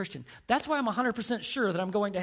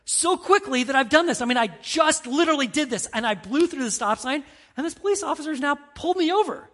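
higher, louder, frantic rush; panicked robber; 8 semitones higher, 8 dB louder than usual. Retold or imitated. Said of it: "the guy was freaking out".

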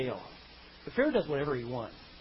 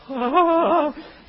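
a real laugh at the joke; loud high laugh; 9 semitones higher, 9 dB louder than usual. Real, not acted. Preacher laughing at his own hillbilly joke, loud and high. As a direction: burst out laughing, higher and louder.

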